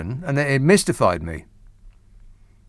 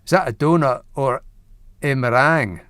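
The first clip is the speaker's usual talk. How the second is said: Scottish accent. Shown as a demonstration. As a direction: joke punchline in a Scottish accent. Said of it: "In a Scottish accent sounds".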